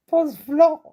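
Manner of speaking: excited fan. Pretend. Teacher acting out a fan gushing about Pause Flow, high and loud. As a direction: gush about the rapper.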